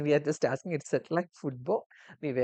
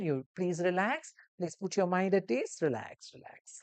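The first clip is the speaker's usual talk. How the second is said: raised, higher soothing voice; reassuring nurse; 4 semitones higher, 3 dB louder than usual. Acted. Nurse introducing himself to a scared patient, soothing. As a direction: make the patient feel safe.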